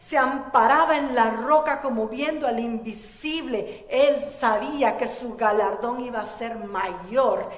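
loud, driving preacher's proclamation; fervent sermon climax; full-force preaching. Acted. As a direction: preach it out with fire to the congregation.

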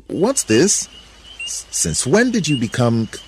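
high, stammering surprise; baffled Adam. A narrator's reading. Adam questioning Eve's new shyness, stammering disbelief.

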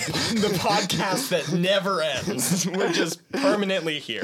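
laughing. Real, not acted. Joking laughter.

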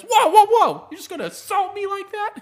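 high, loud mock panic; imitated alarmed protest. Man who asked to be tackled, now protesting in mock alarm. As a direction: yelp it as the tough guy suddenly backing off.

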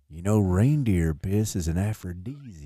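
low, slow, creepy murmur; a sleazy come-on; lightly voiced creep; creepy wink voice.

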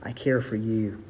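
God whispering to his people, softly tender. Acted. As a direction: breathe it gently into their ear. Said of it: "God whispers in your ear".

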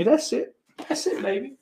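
high, softening falsetto; squeaky mock voice. Streamer teasing his viewers, high mock voice.

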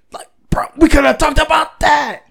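gasp, then a high shouted complaint; gasping outburst. Retold or imitated. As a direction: burst out in disbelief. Retelling his own frustration.

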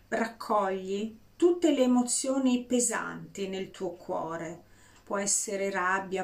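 ordinary delivery

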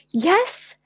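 high, eager yelp of delight; excited yes. A narrator's reading. Child asked to find firewood, high and excited. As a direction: jump at the chance. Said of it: "Gwen jumped up and down".

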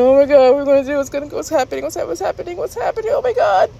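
acted-out mounting panic; rising panic. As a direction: build from alarm to high squeal. Acts out panic as ride climbs.

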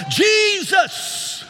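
high shouted cry of the name; loud cry. Retold or imitated. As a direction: cry out for mercy. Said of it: "I cried out with a loud voice".

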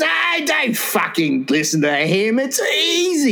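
slow, high, pushy bloke; brash blokey voice. Retold or imitated. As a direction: play the reckless know-it-all mate. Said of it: "he goes".

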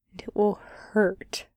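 hushed unvoiced whisper. A narrator's reading. Narrator dropping to a whisper over the coming pain. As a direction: breathe the line out in a whisper.

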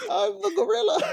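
very high, squeaky mock-emotional voice; gorilla joke. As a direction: squeal it through laughter as the gorilla. Jokingly plays the panicking gorilla.

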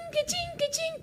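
very high, soft mechanical chime; imitated cash-machine noise. Joking her favourite accent is the cash machine, imitating. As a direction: mimic a card machine's ka-ching. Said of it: "el que hace quichín".

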